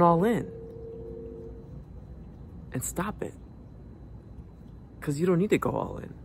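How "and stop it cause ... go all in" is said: normal talking voice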